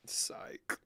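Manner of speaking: whispered "Psych". Real, not acted. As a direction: breathe it out as a sneaky punchline. Whispered joke reveal.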